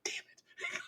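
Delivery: barely audible whispered curse; muttered curse. Retold or imitated. Buddy learning he overpaid for shipping, whispered chagrined curse. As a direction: swear under your breath, voiceless. Friend's chagrined reply retold.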